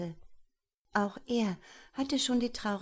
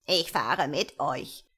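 lower, wobbling creature voice; little gnome voice; 4 semitones lower, 4 dB louder than usual. Acted. Gnome accepts a ride with the sisters, odd lower gnome voice. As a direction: answer as a proud little gnome.

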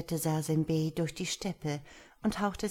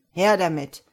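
much louder, short merchant's call; raised-voice eager demand; 10 dB louder than usual. A narrator's reading. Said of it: "rief der Kaufmann".

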